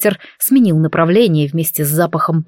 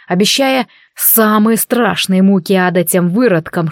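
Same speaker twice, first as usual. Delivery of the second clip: ordinary delivery, nothing marked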